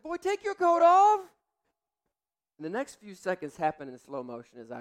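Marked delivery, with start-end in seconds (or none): 0.0-1.2 high, loud stern dad bark; stern yell. Retold or imitated. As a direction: order him like an angry father. Father's stern order to son.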